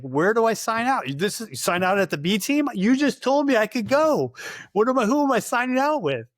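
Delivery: high, rising retold indignation; indignant protest. Soldier arguing with superior over signing out, indignant. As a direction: argue back incredulously, pitch climbing high.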